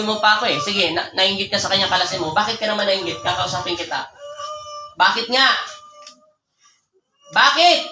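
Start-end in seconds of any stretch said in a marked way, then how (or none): 5.0-7.8 impatient demand. Real, not acted. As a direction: demand why, pitched up and forceful. Healer repeats demand after no answer. (pasigaw na tanong, mapilit)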